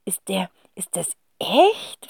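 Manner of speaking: awed whisper. Acted. Kind fragt erschrocken.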